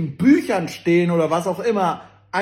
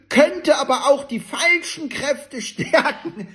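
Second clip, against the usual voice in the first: higher, the last words dissolving into laughing; breaking into laughter; 5 semitones higher, slower than usual. Real, not acted. Reading the newspaper's quote to viewers, breaking into laughter. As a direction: read it until laughter takes over.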